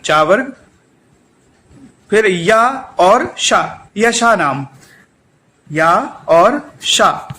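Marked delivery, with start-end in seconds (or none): 2.1-7.3 slow teaching. Shown as a demonstration. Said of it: "teaching the sounds".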